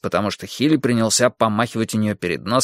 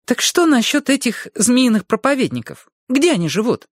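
put-on high, eager boy talking fast; a keen young boy pressing for answers; 12 semitones higher than usual. Boy character Hilly speaking. (голос взволнованного мальчишки)

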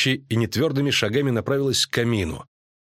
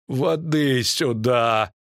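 calling out; 3 semitones higher, much slower than usual. A narrator's reading. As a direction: stretch the words into a slow call. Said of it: "кричал один из трепских братьев". (протяжный крик о помощи)

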